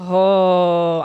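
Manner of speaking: ordinary delivery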